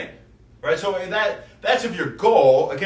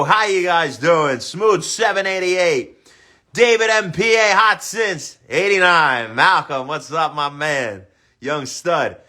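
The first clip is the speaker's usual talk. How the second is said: normal talking voice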